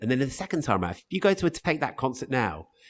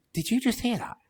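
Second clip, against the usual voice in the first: high-pitched stunned question; acted-out incredulous gasp of a question; retold jaw-dropped disbelief; 10 semitones higher than usual. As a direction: re-enact being astonished, pitch jumping up. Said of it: "our jaws just dropped".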